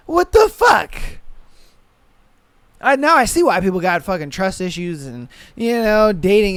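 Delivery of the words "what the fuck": high, loud what the fuck; sudden high exasperated shout; exasperated yelp. Real, not acted. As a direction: burst out in disbelief. Exasperated outburst at the story.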